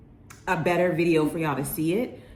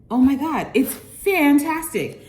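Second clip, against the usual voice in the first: excited praise; 6 semitones higher, 5 dB louder than usual. Real, not acted. Raving about food.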